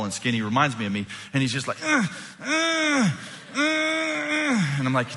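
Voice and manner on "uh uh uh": very high, drawn-out eager squeals; imitated straining grunts. Obnoxious kid begging to answer, imitated squeal. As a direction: squeal like a kid desperate to be called on.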